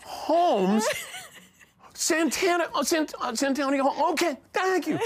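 very high, frantic retold shouting; flustered yelling. Reenacting learning the winner mid-chaos, flustered high yelling. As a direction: stumble over the name at a high yell. Acting his flustered yelled reply on podium.

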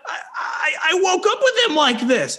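high-pitched, stammering protest; flustered defense. Retold or imitated. Guest defending himself to rabbit's owner, flustered. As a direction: imitate panicked pleading, voice way up.

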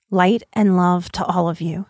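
usual speaking voice

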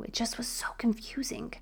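breathy aside, whispered. Real, not acted. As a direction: confide it as a hushed secret. Confiding her confusion to listener.

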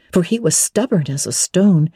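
plain reading voice

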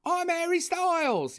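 mocking British accent impression. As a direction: send up his British accent, slow and high. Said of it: "there's him all like".

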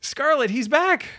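high, bright relief at the return; excited. Real, not acted. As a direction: announce it like good news. Dave reconnects after dropping out.

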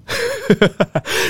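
bright high burst of laughter; sudden squeal of a laugh. Real, not acted. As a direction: laugh at your own guilty choice. Laughs at own choice.

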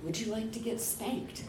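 soft, mock-intimate murmur; hushed come-on, lightly acted; whispered invitation. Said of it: "he whispers in my ear".

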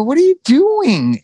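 retelling asking her what she was doing; shocked question. Retold or imitated. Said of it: "I go".

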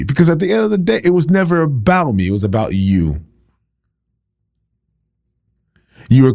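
healed man confronting his doubters, passionate preaching; preaching at full force. Acted. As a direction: drive it home to the congregation.

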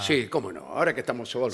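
soft low confiding answer; hushed. Pretend. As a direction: murmur it like a secret.

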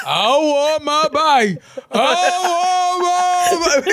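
high, drawn-out wail mimicking a child; wailing little brother. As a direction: stretch each word into a crying whine. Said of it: "And he used to".

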